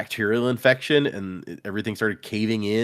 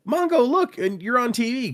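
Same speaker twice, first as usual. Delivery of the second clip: much higher, delighted cat calling out; excited Donut voice; 14 semitones higher than usual. Retold or imitated. Donut calling her pet dinosaur, thrilled and much higher. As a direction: squeal it like a thrilled pampered cat.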